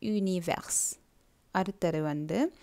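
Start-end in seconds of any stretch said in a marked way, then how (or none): 0.0-1.0 French "univers", ü-nee-vair; rounded u, no y glide in "univers"; French pronunciation. Shown as a demonstration. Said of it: "Universe-ஐ நீங்க".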